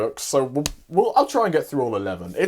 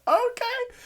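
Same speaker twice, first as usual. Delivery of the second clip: meek squeaky okay; 4 dB quieter than usual. Retold or imitated. Both cowed by Makima.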